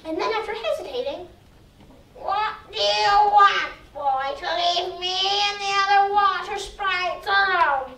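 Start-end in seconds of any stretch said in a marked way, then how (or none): 2.2-7.9 very slow, drawn-out spooky sprite; water sprite voice. Acted. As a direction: stretch every word like a lake spirit.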